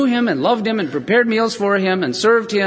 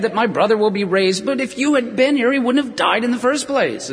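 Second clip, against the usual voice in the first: higher, eye-rolling "I know, I know"; exasperated Martha; 6 semitones higher than usual. Acted. Martha to Jesus, exasperated and eye-rolling. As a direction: sigh it out like an impatient friend, pitched up. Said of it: "rolling her eyes".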